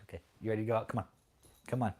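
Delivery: usual speaking voice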